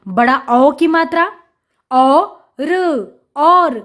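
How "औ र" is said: औ and र said apart; sounding out. Shown as a demonstration. Teacher breaking a word up for children, sound by sound. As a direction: draw out औ, pause, then र. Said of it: "उच्चारण करके पढ़ लिया".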